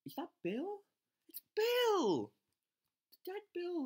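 high, loud cry of recognition; delighted shock at finding him; sudden squeal of surprise; excited surprise. Real, not acted. Spotting a fallen troop.

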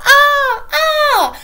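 high shrieking cries; screaming. A narrator's reading. Mother screams running round the table. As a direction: shriek while fleeing. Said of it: "en criant".

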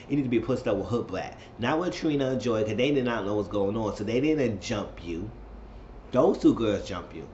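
ordinary delivery

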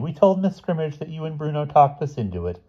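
put-on high-pitched, breezy girl's voice; breezily offhand, in a high voice; airy. A narrator's reading. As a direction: explain it airily, pitched well up. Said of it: "said Kathy airily".

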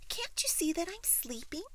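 high little-bird protest; complaining baby bird. A narrator's reading. Baby bird woken from its nap, high complaint. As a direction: whine it up high like a small bird.

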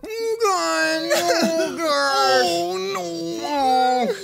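mock horror. Mock horror at smashed food.

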